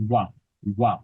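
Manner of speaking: ওয়া as a w-like ব, lips not touching; ব without lip contact. Shown as a demonstration. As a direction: say ওয়া with the two lips kept just apart. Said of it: "এই একটা sound আসবে". (দুই ঠোঁট না ছুঁইয়ে ব-কে ওয়া উচ্চারণ)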